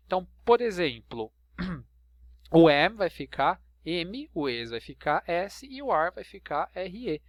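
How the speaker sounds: usual speaking voice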